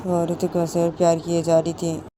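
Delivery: usual speaking voice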